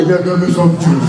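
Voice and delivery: low, deep voice from the same lady; deep voice after the waist trainer comes off; deep real voice. Retold or imitated. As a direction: drop the voice deep like a man. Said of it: "My real voice comes out".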